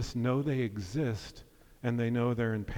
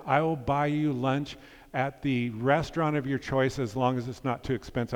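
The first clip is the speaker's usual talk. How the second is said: normal talking voice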